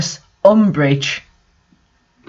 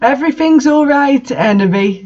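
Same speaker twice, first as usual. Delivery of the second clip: mock sensitive; 7 semitones higher than usual. A narrator's reading. Vampire comforting Ebony, hammed-up sensitive. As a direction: play a sensitive emo boy. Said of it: "all sensitive".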